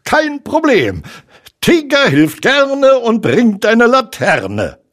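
loud, very high bouncy tiger; high, exuberant cartoon-tiger voice; Tigger. A narrator's reading. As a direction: burst in eager to help. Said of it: "rief Tigger".

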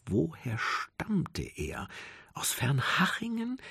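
slow, soft self-questioning; slow anxious wondering. A narrator's reading. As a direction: ponder it slowly under the breath. Rumo anxiously asks himself.

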